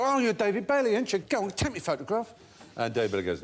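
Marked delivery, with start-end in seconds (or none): 0.0-2.3 put-on drunk pestering. Drunk in the pub begging David Bailey for a photo, high mock slur. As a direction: badger him like a pub drunk. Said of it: "they go".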